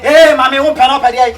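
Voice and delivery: loud playful call with a laugh; loud teasing call. Real, not acted. Man teasing his partner at the bar, loud and laughing. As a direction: tease her loudly across the room.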